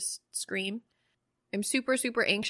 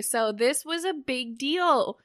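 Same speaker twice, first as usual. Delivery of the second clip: normal talking voice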